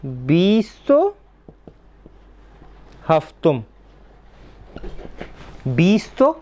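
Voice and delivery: very slow, drawn-out बीस तो; teacher spelling it out; slow demonstration. Shown as a demonstration. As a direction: build the number word by word. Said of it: "तो हम क्या कहेंगे?".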